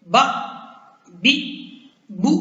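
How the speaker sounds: "बा", "बी", "बू" each short and cut off sharply; with a jolt. Shown as a demonstration. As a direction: jerk each of "बा", "बी", "बू" to an abrupt stop. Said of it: "झटके के साथ पढ़ेंगे".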